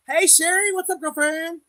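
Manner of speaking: high, loud bubbly hello; cheery greeting. Real, not acted. To a viewer joining the live, a high excited hello.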